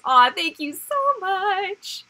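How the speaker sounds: high, loud, slow and drawn-out gratitude; effusively touched; gushing thanks. Real, not acted. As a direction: let the thanks soar and stretch.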